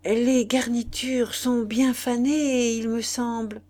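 ordinary delivery

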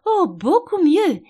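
high, loud, drawn-out exclamation; crying out. A narrator's reading. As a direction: exclaim it slowly and heartily. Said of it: "s'écria la reine".

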